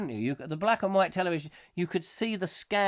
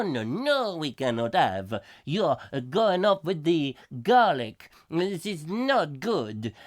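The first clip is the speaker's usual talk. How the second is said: hammed-up French policeman accent; mock French accent; 3 semitones higher than usual. Host voicing a French TV policeman in mock French accent. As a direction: play a comic French policeman. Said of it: "it was in French".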